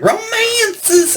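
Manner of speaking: very high, stretched-out mock rock vocal. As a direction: squeal it high like a flamboyant singer. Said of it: "I just love when he says that".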